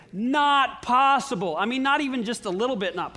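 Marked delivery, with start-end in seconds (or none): 0.1-1.6 very slow, firm Not possible with weight on Not; emphatic, drawn-out Not possible; loud and clear. Retold or imitated. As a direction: spell out Not possible like a final verdict. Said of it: "came back so loud and clear".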